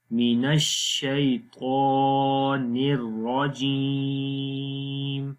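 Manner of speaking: slow melodic tajweed chant; Quran recitation. Shown as a demonstration. Teacher reciting the phrase for students. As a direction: recite الرجیم drawn out and melodic. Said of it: "اب اس پورے کو میں پڑھتا ہوں".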